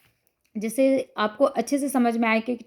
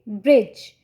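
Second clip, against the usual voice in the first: Bridge said lower and louder as the correct reading, with a firm fall; triumphant answer; 3 semitones lower, 5 dB louder, much slower than usual. Shown as a demonstration. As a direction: land bridge as the easy answer. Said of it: "तो कैसे पढ़ पाएंगे बच्चे".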